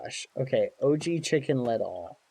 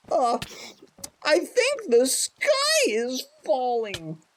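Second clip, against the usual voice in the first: squawky rooster yeah; Ruby's voice; 13 semitones higher, slower than usual. Acted. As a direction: play a melodramatic rooster. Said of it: "I'll do a voice for Ruby".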